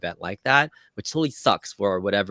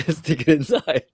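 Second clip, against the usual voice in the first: giggling through playful instructions, pitched up; laughing; 6 semitones higher, 4 dB louder than usual. Real, not acted. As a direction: laugh while giving a silly instruction. Joking gesture instructions.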